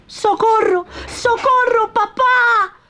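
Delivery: very high, drawn-out puppet pleas; a terrified little puppet begging not to die; frightened cries for help. Acted. Locked-up Pinocchio calls for help, very high and frightened. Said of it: "conmovido con sus gritos".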